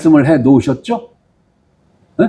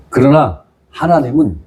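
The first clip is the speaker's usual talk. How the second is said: usual speaking voice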